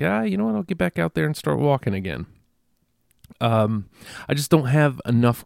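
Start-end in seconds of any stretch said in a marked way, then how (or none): 0.0-2.3 bright, much higher, breezy resolve; a naive burst of optimism, lightly hammed; mock upbeat self-quote. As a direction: play your past self cheerfully deciding. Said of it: "when I was like".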